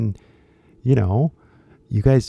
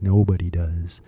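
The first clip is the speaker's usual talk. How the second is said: ordinary talk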